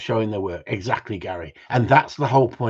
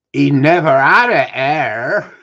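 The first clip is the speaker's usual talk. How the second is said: slow, loud Somerset drawl, pitched up; deep Somerset voice; 4 semitones higher, 11 dB louder, slower than usual. Retold or imitated. Panel judge quoted in a deep Somerset accent. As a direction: lean into a broad Somerset accent. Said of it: "in that deep Somerset voice".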